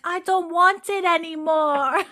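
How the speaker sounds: put-on high, drawn-out toddler whine; imitated three-year-old in a slow high voice; Jemmy. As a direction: refuse like a small child, high and slow. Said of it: "And he's like".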